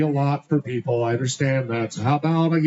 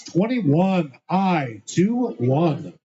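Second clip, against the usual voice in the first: slow call; much slower than usual. Acted. Bingo caller repeats number.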